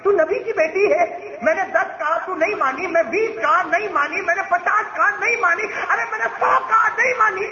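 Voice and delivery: high, mounting exasperation; angry reproach. A narrator's reading. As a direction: narrate an angry father's rising protest. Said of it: "غصے میں کیا فرما رہے ہیں؟". (غصے میں اونچی آواز سے شکوہ)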